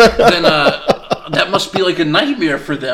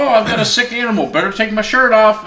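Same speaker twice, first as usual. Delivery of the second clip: high, hammy TV vet; lightly voiced cheerful TV vet, pitched up; Doctor Pol voice; 7 semitones higher than usual. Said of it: "That dude on T V who's like".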